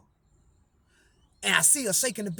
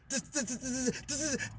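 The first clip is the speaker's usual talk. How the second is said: much softer, faint halting repetition; struggling faintly; 13 dB quieter, slower than usual. Retold or imitated. Dying cousin straining to speak to nephew, faint and halting. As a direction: fight to get the words out.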